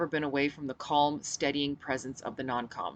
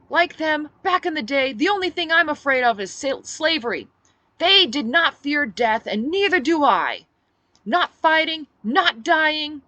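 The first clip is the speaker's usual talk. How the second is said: rousing speech; 11 semitones higher, 10 dB louder than usual. Acted. Speech to crowd.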